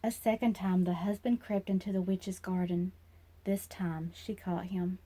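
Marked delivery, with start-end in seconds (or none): none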